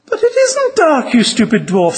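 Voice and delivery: high-pitched, exasperated storybook heroine; acted exasperation, starting very high; exasperated protest. A narrator's reading. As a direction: protest in a high voice, dropping on the insult. Exasperated Lucy argues with dwarf.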